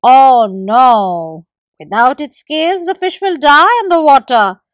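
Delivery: loud, firm gecko; gecko refusing. A narrator's reading. Gecko refuses the squirrel again, loud. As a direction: refuse him outright.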